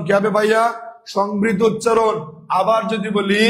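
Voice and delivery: ordinary delivery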